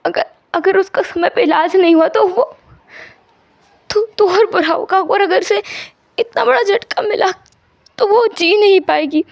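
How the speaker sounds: high, halting, on the verge of tears; tearful pleading. Acted. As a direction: choke up begging for a sick mother. Wang fears for his sick mother.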